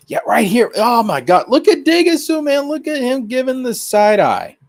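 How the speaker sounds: sudden high, loud burst of delight; excited. Real, not acted. Streamer spotting the demon lady picture, excited high outburst. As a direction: gasp it out like you just spotted the proof.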